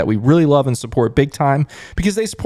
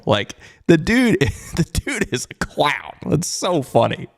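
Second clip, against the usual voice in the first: slow, amused, laughing through the words; 3 semitones higher, slower than usual. Real, not acted. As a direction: laugh in disbelief as you say it. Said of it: "Why I'm laughing is".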